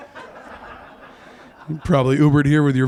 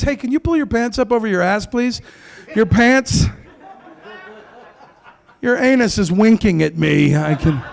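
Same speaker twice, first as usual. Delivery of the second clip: raised, exasperated scolding; scolding older man; 7 semitones higher than usual. Retold or imitated. Comic scolding young people about sagging pants, higher exasperated voice. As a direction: tell the kid off about his pants. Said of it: "we've been telling".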